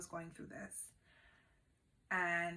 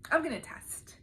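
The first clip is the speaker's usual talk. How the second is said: higher and louder, suddenly eager; eager decision; 8 semitones higher, 7 dB louder than usual. Retold or imitated. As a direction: blurt it out, can't wait. Retelling sudden decision to test.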